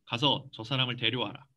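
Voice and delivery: gently acted order; Jesus. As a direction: give a quiet, low instruction. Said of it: "예수님 이렇게 하세요".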